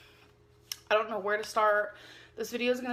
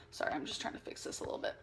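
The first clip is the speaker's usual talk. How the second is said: quick mumbled aside; 7 semitones lower, 13 dB quieter, much faster than usual. Real, not acted. Mumbled aside while fixing.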